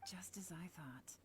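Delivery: hushed, satisfied aside; whispered aside. Acted. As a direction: whisper it so the kid barely hears. Quiet aside before kid asks "Huh?.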